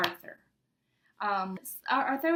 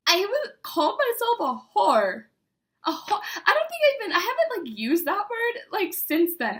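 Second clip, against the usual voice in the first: higher, louder stunned laughing outrage; shocked disbelief; 8 semitones higher, 5 dB louder than usual. Real, not acted. Shocked at own old note.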